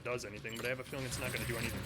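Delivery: ordinary talk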